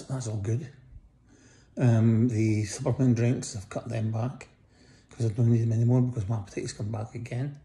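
ordinary talk

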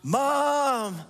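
high, loud drawn-out call; hollering for mom from another room; a kid calling out for mom; child yelling for mom. Retold or imitated. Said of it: "we know what they really say".

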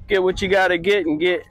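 usual speaking voice

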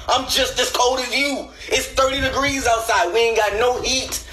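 high-pitched whiny complaining about the cover; annoyed boyfriend. Acted. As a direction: nag your partner for stealing the blanket. Boyfriend voiced hogging the cover.

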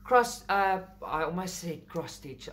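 ordinary talk, nothing marked

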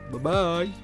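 ordinary talk, nothing marked

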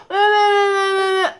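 high, loud repeated why; loud what-is-it. Real, not acted. Mother asking her screaming baby why, loud and high. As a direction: call over the crying.

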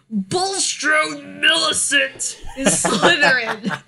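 hammed-up announcement dissolving into laughter; booming mock announcement. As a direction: boom out the ungainly name, then crack up laughing. Comic contrast with the gentler names.

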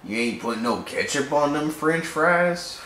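slow, lightly put-on bad French accent, barely French at all; a half-hearted French voice that keeps slipping into Southern drawl; terrible French accent. Friends joking about an alien's food, in a terrible French accent. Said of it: "Terrible French accent".

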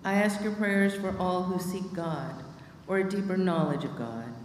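ordinary talk

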